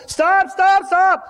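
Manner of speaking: high, loud urgent stop; calling out stop. Acted. Jesus telling the pressing crowd to stop, loud high call. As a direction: halt the whole crowd with it.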